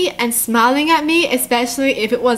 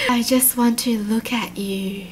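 creepy voice; 5 dB quieter than usual. Acted. Creature calling out to hidden Annie, soft creepy voice. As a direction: coax softly like something sinister. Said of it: "that was creepy".

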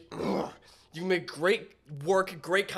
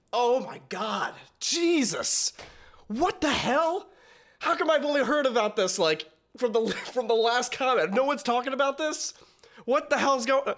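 pitched way up in amazement; excited disbelief; 7 semitones higher than usual. Real, not acted. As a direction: blurt it out, floored by the verse. Stunned reaction to a rap verse.

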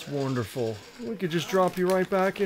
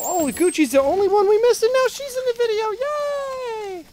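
much higher, squealing delight; excited squeal; 4 dB louder than usual. Real, not acted. Dad cheering that the dog made the video, excited squeal. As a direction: squeal with joy.

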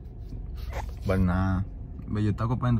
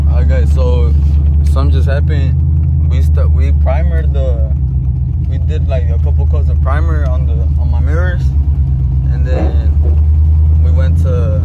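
loud, urgent rush of news; 3 semitones higher, 11 dB louder than usual. Real, not acted. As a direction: blurt out what just went wrong. Paint container leaking, urgent update.

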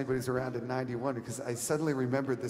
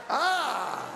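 triumphant ah; 13 semitones higher, 4 dB louder than usual. Real, not acted. Crowd caught laughing at game.

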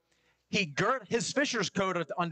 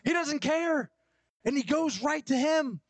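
high, louder excited shout; Peter excited; 12 semitones higher, 3 dB louder than usual. Retold or imitated. Preacher voicing Peter's joy on seeing Jesus, high excited shout. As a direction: cry it out like you can't wait. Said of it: "It's like".

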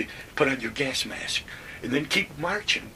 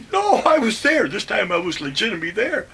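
loud, much higher indignant denial; indignant protest; 9 semitones higher, 7 dB louder than usual. Retold or imitated. Narrator insisting to the office he made roll call, loud and indignant. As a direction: protest your innocence hard.

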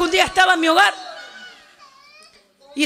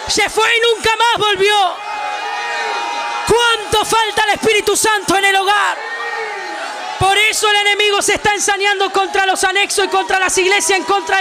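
impassioned Pentecostal preacher; fiery, sustained revival shouting; full-force preaching; 4 semitones higher than usual. Real, not acted.

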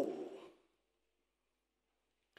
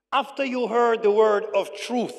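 louder, measured scripture reading; slow reading; 5 semitones higher, 9 dB louder than usual. Real, not acted. Preacher reading Paul's verse aloud to church, slow reading. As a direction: proclaim the verse phrase by phrase. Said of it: "I'm gonna read it slowly".

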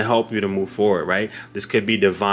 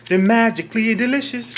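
high, sing-song TV commercial voice; playful imitation of a breakfast cereal jingle line; 12 semitones higher than usual. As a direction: chirp it like a cartoon cereal ad. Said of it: "I just heard".

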